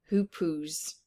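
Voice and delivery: usual speaking voice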